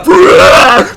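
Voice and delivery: loud, high imitated roar; monster roar. Game master voicing a noise from inside the room, monster roar. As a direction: let out a sudden creature roar.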